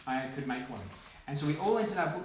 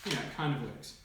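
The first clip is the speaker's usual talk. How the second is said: ordinary delivery, nothing marked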